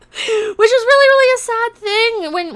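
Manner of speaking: high, loud mock sympathy; mock pity. Woman taunting her online bully, mock pity. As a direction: pitch it way up in exaggerated pity.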